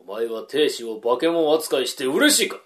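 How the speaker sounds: ordinary talk, nothing marked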